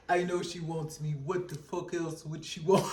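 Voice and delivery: a lightly voiced smug player; cocky guy. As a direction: play the arrogant man sure of himself. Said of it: "He like".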